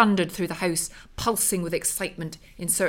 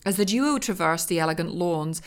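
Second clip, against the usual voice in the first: ordinary delivery, nothing marked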